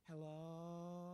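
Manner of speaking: soft, drawn-out Southern hello. Retold or imitated. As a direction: stretch the Southern hello sweetly. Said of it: "I hear this southern voice say".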